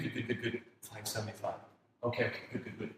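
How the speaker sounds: soft, repeated self-reassurance; hushed mock relief; acted relief. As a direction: reassure yourself under your breath. Relieved trader watching price fall.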